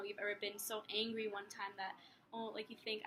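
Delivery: usual speaking voice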